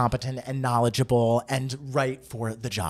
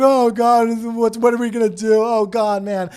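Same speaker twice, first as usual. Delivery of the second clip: put-on high and loud, jokey teen panic; imitating teenagers laughing in mock despair; mock panicking teens; 8 semitones higher, 7 dB louder than usual. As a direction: play teens giggling at doom. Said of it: "they're just like".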